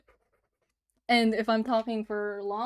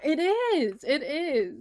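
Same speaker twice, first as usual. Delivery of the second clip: excited; 5 semitones higher, 6 dB louder, much slower than usual. Real, not acted. Excited agreement with chat.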